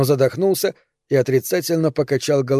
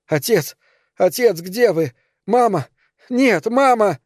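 put-on a boy calling for his parents as they drag them off; frantic cries building to a shriek; desperate screaming; 7 semitones higher than usual. A narrator's reading. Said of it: "Роберт закричал, что было сил".

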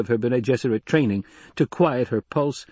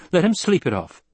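higher, curt command; ordering; 7 semitones higher, 3 dB louder than usual. A narrator's reading. Lady dismissing the drunk to guards, commanding. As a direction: dismiss him with a firm order. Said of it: "she ordered".

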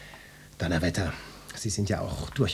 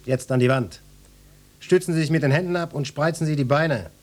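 firm, raised-pitch police orders; commanding; 4 semitones higher than usual. Acted. Inspector ordering a salesman against the wall, commanding. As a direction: take control of the suspect.

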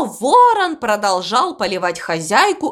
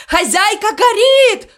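high, panicky shout; alarmed shouting; 11 semitones higher, 4 dB louder than usual. A narrator's reading. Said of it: "кричал он". (панический высокий крик)

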